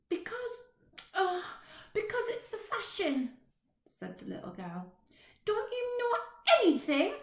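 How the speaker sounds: put-on high, chatty little girl, a bit bossy. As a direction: play a cheeky small girl. Little girl's voice in story.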